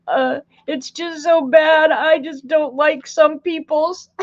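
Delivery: put-on high, slow, tearful little-girl voice; little girl crying. Mother asks crying daughter, teary little-girl voice. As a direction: whimper it like a sad small child. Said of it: "She said".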